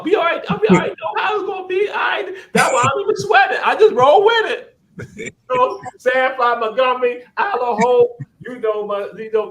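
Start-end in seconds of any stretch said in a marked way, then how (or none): 0.0-8.2 high, loud shout through laughter; cracking up while shouting out; real laughing holler. Real, not acted.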